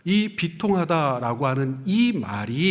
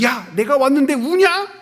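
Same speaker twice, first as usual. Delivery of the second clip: mock rebuke; 8 semitones higher, 6 dB louder than usual. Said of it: "마르다를 막 꾸짖으시는 거야?".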